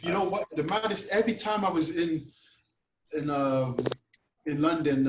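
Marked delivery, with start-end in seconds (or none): none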